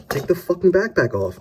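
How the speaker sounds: high, loud exasperated rant. Real, not acted. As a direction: vent at the complainers. Ranting at complainers.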